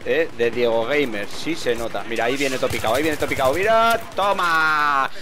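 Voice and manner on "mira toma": high, loud call that lands triumphantly; excited cheer. Real, not acted. Gamer celebrating a kill on his clip, excited cheer. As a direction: cheer the hit with a sudden burst.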